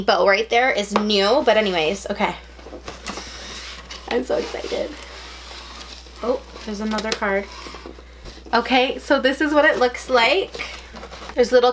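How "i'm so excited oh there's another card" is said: whispered, hushed thrill; excited whisper. Real, not acted. Opening the box at last, she whispers in excitement. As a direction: breathe it out in a giddy whisper. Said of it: "Sorry if I sound so dramatic".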